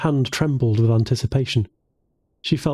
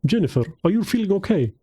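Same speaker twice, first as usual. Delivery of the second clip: put-on thick Russian accent, raised pitch; 6 semitones higher than usual. A narrator's reading. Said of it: "Nikolai's thick Russian accent".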